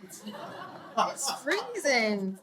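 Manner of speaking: drawn-out laughing complaint; very slow laughing shiver. Acted. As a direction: laugh through the cold. Arriving in the cold room.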